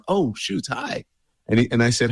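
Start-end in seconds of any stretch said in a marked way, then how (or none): 0.1-1.0 surprised. Retold or imitated. [name] recalling his startled greeting to Doug, high surprised squeak. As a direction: pitch jumps up, trailing to a soft "hi". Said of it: "I'm like".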